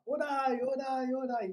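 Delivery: high, loud sing-song calls of orai; gas station attendant calling. Retold or imitated. Attendant guiding a car to the pump, loud high calls. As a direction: wave the driver in, calling out. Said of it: "o atendente do posto falava lá".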